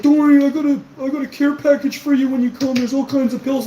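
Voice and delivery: high-pitched put-on voice of a rival; mocking imitation. Streamer imitates a man promising a care package, mocking.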